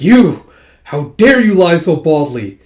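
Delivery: loud, drawn-out indignant accusation; acted outrage. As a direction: explode at a brazen liar. Character outraged at admitted lie.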